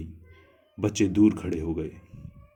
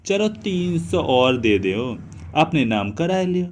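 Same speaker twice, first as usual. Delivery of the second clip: put-on haggling old aunt; 10 semitones higher, 5 dB louder than usual. A narrator's reading. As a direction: bargain loudly like an old aunt. (मोलभाव करती बुआ की ऊँची आवाज़)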